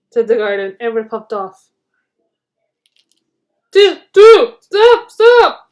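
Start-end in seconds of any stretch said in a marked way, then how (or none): 3.7-5.7 high, loud desperate wail. A narrator's reading. Bertie the bus wailing after the leaving train. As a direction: cry out after the departing train. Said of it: "wailed Bertie".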